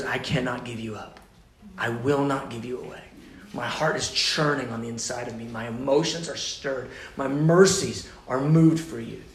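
soft, low, tender confession. Acted. God softly telling faithless Israel he cannot let go. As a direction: speak it gently, as a grieving lover.

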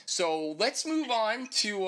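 slow and winded; out of breath. Real, not acted. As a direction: catch your breath between words. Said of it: "I'm winded right now".

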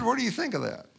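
a mysterious stranger asking about the altarpiece; stranger's voice. Retold or imitated.

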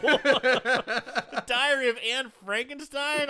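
laughing. Real, not acted. Laughing disbelief at title.

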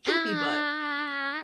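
high, slow goofy whine; silly cartoon voice. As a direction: ham up a ridiculous name. Silly podcast name intro.

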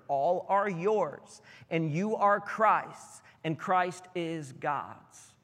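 slow weighty reading. Real, not acted. Pastor reading the climax to his congregation, very slow. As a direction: let the verse land word by word.

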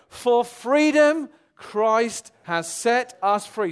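loud, very slow congregational reading; normal reading. Shown as a demonstration. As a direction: read it out slowly for all to join in. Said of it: "let's just read it normally".

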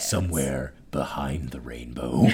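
low breathy trailer whisper, slow; horror trailer voice. Pretend. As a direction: growl it softly like a spooky teaser. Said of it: "I'm gonna do the rest of the podcast in this voice".

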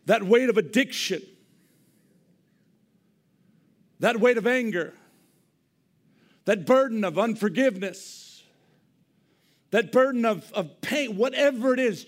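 loud, high, emphatic list; impassioned loud listing; loud preaching. Real, not acted. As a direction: hammer each burden loudly.

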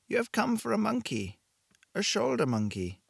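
put-on calm, knowing woman with an accent from somewhere in Europe; vague European accent. A narrator's reading. Shopkeeper tells Blake why he came, vague European accent. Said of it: "Her accent was impossible to place. Somewhere in Europe".

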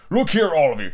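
plain reading voice

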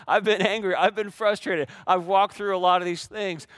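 laughing admission; a wry laugh at himself. Real, not acted. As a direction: chuckle through it.

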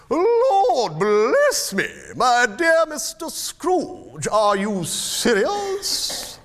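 very high, loud, long-drawn exclamation; astonished old gentleman. Acted. Old gentleman hearing Scrooge's whispered gift, utterly astonished. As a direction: stretch it out in high-pitched amazement.

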